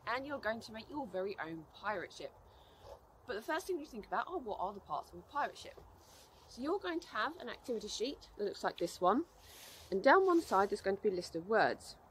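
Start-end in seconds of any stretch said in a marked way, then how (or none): none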